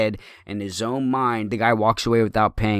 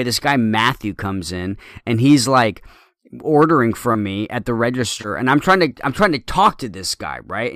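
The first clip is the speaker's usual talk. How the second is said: ordinary talk, nothing marked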